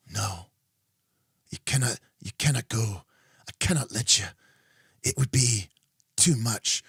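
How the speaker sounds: hushed, solemn hero voice; serious in-character voice. Pretend. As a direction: play it grave and dramatic. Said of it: "You like my role-playing voice?".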